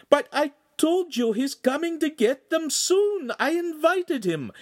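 high, slow, distressed wail; panicked. Acted. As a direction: plead in rising alarm. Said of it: "the Signora cried".

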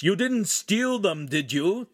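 serious master checking on his apprentice. A narrator's reading. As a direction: press the question earnestly. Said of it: "the master asked seriously".

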